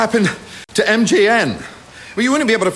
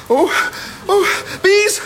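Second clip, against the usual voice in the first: high-pitched, frantic alarm; panicked shouting; 11 semitones higher than usual. Acted. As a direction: cry out in rising panic at a swarm. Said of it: "Oh, don't shout".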